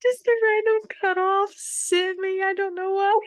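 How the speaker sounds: can't stop laughing; laughing fit. Real, not acted. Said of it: "You're welcome".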